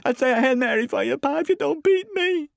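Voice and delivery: put-on very high, loud child's pleading; terrified little boy wailing; shaking with fright. A narrator's reading. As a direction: beg frantically not to be beaten. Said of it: "his voice shook with fright".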